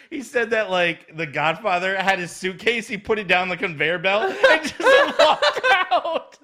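can't stop cracking up while telling it; laughing hard. Real, not acted. Host finishing the airport story to cohost, helpless laughter.

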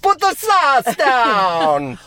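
slow, high and loud; character voice. Acted. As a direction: drag it out, booming. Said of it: "it's another character".

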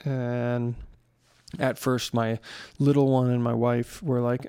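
ordinary talk, nothing marked

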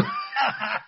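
high burst of laughter. Real, not acted. Punchline of [name] joke.

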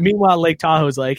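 ordinary delivery

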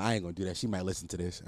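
normal talking voice

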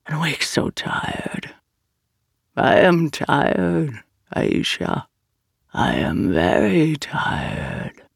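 slow, exhausted whisper; weary whisper. A narrator's reading. The ancient Ifrit tells Aisha its weariness, whispering. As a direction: let tiredness drain the voice.

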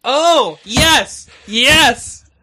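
loud, high cries of delight; joyful scream. Real, not acted. Co-host thrilled about a forwarded email, joyful scream. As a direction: exult over each word, high and loud. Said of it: "Why would I scream, "Oh, yes"? In, in joy".

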